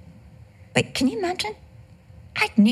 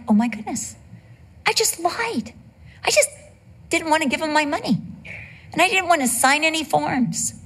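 flustered realization; 7 semitones higher than usual. Retold or imitated. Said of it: "And I'm like".

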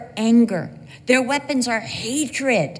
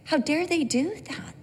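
whispered plea to the Lord, much softer; hushed prayer; 4 semitones higher, 8 dB quieter than usual. Retold or imitated. Preacher retells his whispered prayer about cruel comments, hushed. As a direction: breathe it as a shocked private prayer. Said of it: "I'm like".

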